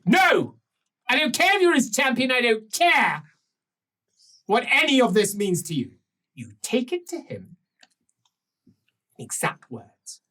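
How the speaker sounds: dismissive countess. Acted.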